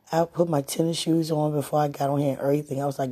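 usual speaking voice

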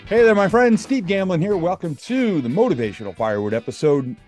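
ordinary delivery

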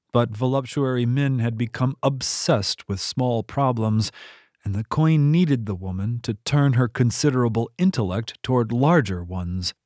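ordinary delivery, nothing marked

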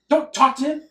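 loud, urgent hushing order; sharp warning. Retold or imitated. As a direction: snap at him to stop talking. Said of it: "his buddy's like".

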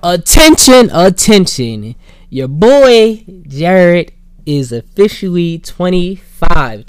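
booming mock announcement; announcer voice. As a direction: announce it like a herald. Mock announcement of own birthday.

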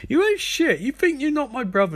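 high-pitched, hammed-up outrage; high mock-quarrel voice; mock indignant retort. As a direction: imitate an outraged sister, pitched right up. Sister's angry retort in retold quarrel.

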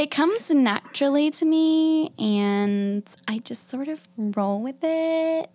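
put-on amplified Valley Girl drawl, stretching the endings; Valley Girl accent. Shown as a demonstration. Host demonstrates her best held accent, exaggerated Valley Girl. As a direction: lean hard into Valley Girl talk. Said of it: "I could do a Valley Girl accent".

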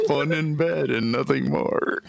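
trying not to laugh. Real, not acted. Poet reading own rude rhyme to the group, trying not to laugh. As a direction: read on while cracking up. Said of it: "You can't read it without laughing".

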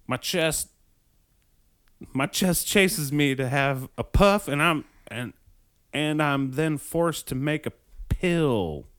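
put-on loosely putting on a Southern American accent. Reading an old man's words to her friend in a mock Southern American accent. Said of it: "I'm gonna do a Southern American accent".

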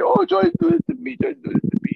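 high, loud mock woman's voice; women accusers. Man imagining women accusing him, high-pitched mock complaint. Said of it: "women come up and say".

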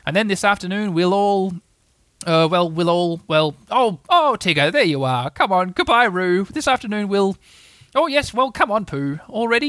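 busy, bossy fluster; flustered hurrying. Acted. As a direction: break off and bustle everyone along. Rabbit flustered, hurrying everyone away.

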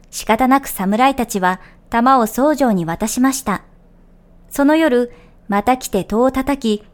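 calm, measured folk tale reading over music; gentle storytelling voice; story narration. A narrator's reading. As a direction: tell the old tale evenly, like a radio storyteller. Reads folk tale on radio show.

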